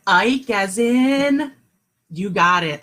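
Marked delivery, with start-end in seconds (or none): 0.1-1.5 slow drawn-out prompt. Shown as a demonstration. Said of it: "Did you say it?".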